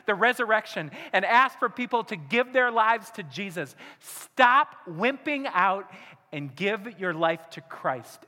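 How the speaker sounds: loud, high, impassioned altar-call preaching; fiery sermon climax, voice ringing out; preaching at full force. Acted. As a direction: drive it home to the congregation.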